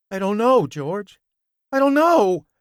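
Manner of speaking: high, loud frightened pleading. A narrator's reading. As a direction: squeal it out in fear.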